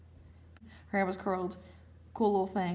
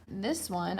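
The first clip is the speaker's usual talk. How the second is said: usual speaking voice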